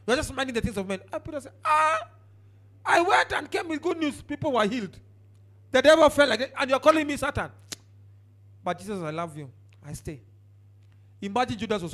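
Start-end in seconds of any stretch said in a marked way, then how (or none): 1.6-7.5 louder, higher protesting disciple; indignant Peter. Retold or imitated. 9.0-10.2 softer, lower, gentle reply; tender Jesus. Retold or imitated.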